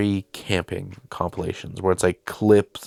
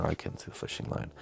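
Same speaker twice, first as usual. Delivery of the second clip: mock whisper; 16 dB quieter, faster than usual. Mock echo of own line.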